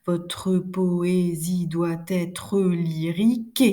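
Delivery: very slow, chopped verse scansion, rising on "lyrique"; syllable-by-syllable scansion. Shown as a demonstration. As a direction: slowly count out each syllable of "Votre poésie doit être lyrique". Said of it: "quand vous recomptez vos propres pieds".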